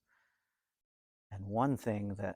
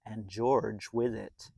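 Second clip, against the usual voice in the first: put-on lively read-aloud voice for a children's story; storybook narration; 8 semitones higher, 12 dB louder than usual. A narrator's reading. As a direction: tell it like a bedtime picture book.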